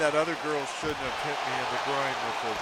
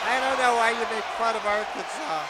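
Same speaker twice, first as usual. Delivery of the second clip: higher voice as the other broadcaster, defending Arkansas; a higher rival commentator voice; 7 semitones higher than usual. Acted. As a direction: answer as the offended second commentator.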